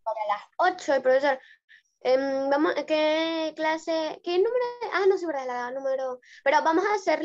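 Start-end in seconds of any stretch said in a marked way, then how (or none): none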